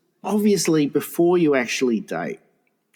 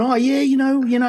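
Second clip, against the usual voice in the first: put-on coy young suitor; 10 semitones higher than usual. Young man trying his luck with an older woman, coy and high. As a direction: imitate a suitor in a much higher voice.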